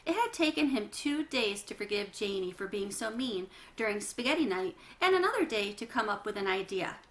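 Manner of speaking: ordinary delivery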